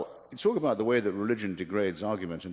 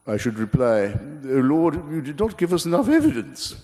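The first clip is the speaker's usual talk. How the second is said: put-on Bertrand Russell's accent; 5 semitones higher, 6 dB louder than usual. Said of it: "I can sometimes imitate his accent".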